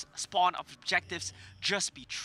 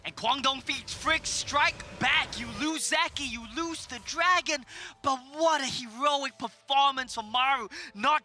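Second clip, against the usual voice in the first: excited caster; 6 semitones higher than usual. Real, not acted. Co-caster reacts to the fight.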